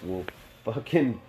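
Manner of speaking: ordinary delivery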